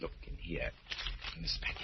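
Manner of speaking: low and secretive, for his ears only; hushed. Acted. Roger secretly shows the surgeon the package, hushed.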